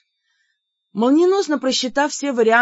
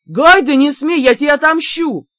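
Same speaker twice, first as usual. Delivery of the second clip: high, loud warning; loud, high-pitched menace; threatening shout; 6 semitones higher, 9 dB louder than usual. A narrator's reading. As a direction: yell a threat mid-chase. Ссора и погоня за тортом.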